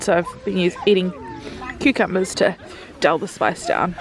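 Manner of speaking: ordinary talk, nothing marked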